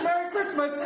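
high, bright Merry Christmas; cheery greeting. Retold or imitated. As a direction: toss it warmly at the server. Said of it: "I said".

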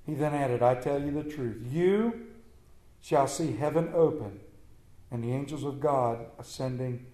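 scripture reading. Acted. Reads the Gospel passage aloud.

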